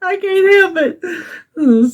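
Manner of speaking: high, breathless laugh then slow giggly line; helpless laughter. Real, not acted. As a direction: admit it mid-laugh, slowly. Laughing at own pettiness.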